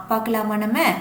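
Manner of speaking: ordinary talk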